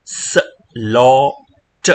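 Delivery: "સ, લો, ટ" slow, in separate pieces; "સ", "લો", "ટ" one sound at a time. Shown as a demonstration. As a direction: draw "સ, લો, ટ" apart with pauses. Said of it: "તમે વાંચશો આને".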